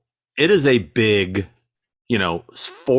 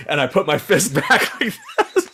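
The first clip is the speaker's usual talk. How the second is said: loud, higher, telling it through laughter; laughing; 8 semitones higher, 3 dB louder than usual. Real, not acted. Storyteller recalls nearly punching his own reflection, laughing. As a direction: crack up at your own story.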